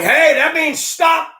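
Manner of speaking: high, slow shout of warning; retold yell. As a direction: yell out the window at a driver. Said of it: "Leaning out the window and yelling".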